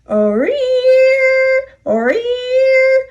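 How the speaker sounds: put-on whiny wail of reluctant old trucks; groaning cars. A narrator's reading. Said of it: "the cars groaned".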